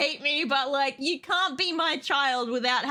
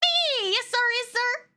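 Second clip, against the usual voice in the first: high squeaky yes sir; lightly squeaked obedient yes sir; squeaky avatar voice; 8 semitones higher than usual. Acted. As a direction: squeak it like an eager little servant. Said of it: "she's meant to be squeaking".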